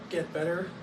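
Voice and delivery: usual speaking voice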